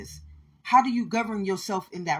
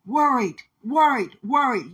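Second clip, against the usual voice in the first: each worried hit higher and louder; emphatic repetition; 5 semitones higher, 6 dB louder than usual. Acted. Preacher naming what they taught him, hammering the word.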